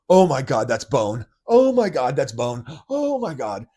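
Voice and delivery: loud, high panicked gasping cries of oh my God; panicked shock. Retold or imitated. Reliving seeing his own bone in panic. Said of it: "I was like, gasps".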